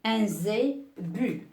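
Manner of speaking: Un zébu drawn out slowly; slow model word. Shown as a demonstration. Teacher repeating zébu slowly for pupils. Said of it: "teacher models slowly".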